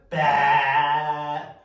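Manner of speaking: a big bah with mouth wide open; loud open-mouth bah. Shown as a demonstration. Teacher checking mouths after the cereal, a loud bah. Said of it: "Anything there?".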